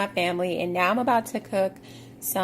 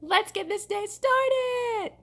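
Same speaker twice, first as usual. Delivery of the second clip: fake cheerful; 10 semitones higher, slower than usual. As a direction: put on an overdone hype voice. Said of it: "That's so fake".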